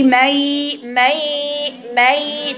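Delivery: "مي" repeated, moving the sound up into the nose; nasal drill. Shown as a demonstration. Teacher drilling the nasal sound "مي" for students. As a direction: slide "مي" from the lips up into the nasal cavity. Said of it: "يبقى زي تقولي".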